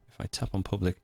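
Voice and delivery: normal talking voice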